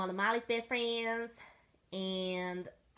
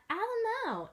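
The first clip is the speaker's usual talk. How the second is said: high voiced; 12 semitones higher than usual. Family member voiced.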